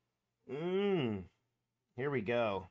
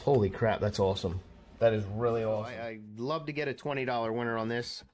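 normal talking voice

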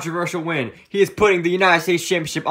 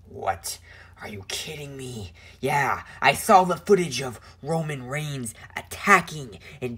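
villain promo voice; 7 semitones lower, 8 dB quieter than usual. Acted. Messiah character low villain promo.

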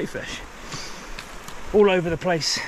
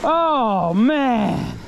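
awed delight; 10 semitones higher, 5 dB louder than usual. Real, not acted. Marvelling at caught fish.

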